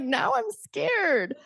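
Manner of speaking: high, slow mock fright; mock scared. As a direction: play at being spooked. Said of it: "I'm like".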